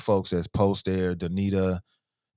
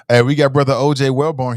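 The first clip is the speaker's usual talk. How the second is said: normal talking voice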